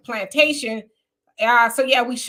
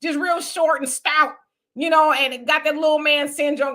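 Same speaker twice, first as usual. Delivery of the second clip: normal talking voice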